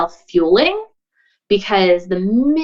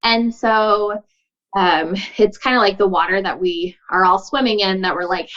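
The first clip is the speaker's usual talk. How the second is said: usual speaking voice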